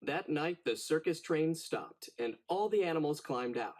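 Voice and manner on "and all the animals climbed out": usual speaking voice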